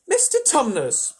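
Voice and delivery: Lucy bawling into the faun's ear; bawled. A narrator's reading. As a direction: shout it into his ear. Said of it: "bawled Lucy into his ear".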